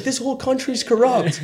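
high put-on rant; imitated ranting caller. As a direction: complain as the angry caller. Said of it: "he basically was screaming at me".

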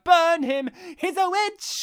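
high, loud witch-hunt yell; mock mob shout. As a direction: shriek it like a mob with torches. Mock angry mob in retold scene.

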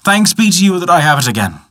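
acted grateful joy. A narrator's reading. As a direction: give thanks with a touch of joy.

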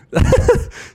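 unvoiced giggle burst at his own joke; high burst of laughter. Real, not acted. Comedian cracking up at his own impression, giggling. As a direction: crack up at the bit.

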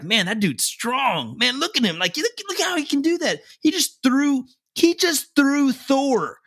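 high, slow, wide-eyed gushing over a movie villain; awed kid. Retold or imitated. A kid marvelling at the villain on screen, high and awed. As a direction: play a child amazed by raw strength, voice way up. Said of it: "They're like".

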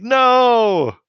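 loud, high mock cry of panic; freaking out. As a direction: imitate a trapped kid's desperate shout. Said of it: "how Enzo is freaking out".

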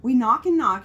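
usual speaking voice

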